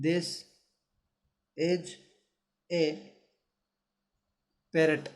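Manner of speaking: each word set apart by long pauses. Shown as a demonstration. Teacher dictating to pupils, slow and spaced. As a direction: dictate it slowly, one word at a time. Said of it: "क्या दिया है".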